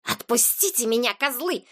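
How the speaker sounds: hissed like an enraged cat; furious hiss. A narrator's reading. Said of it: "прошипел, как разъярённая кошка". (злобное шипение разъярённой кошки)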